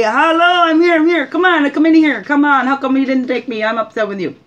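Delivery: high, loud excited dog voice, calming toward the end; voicing the dog. Owner voicing her dog's happy welcome, high and loud. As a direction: speak for the excited dog greeting Papa. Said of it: "so she just had to say".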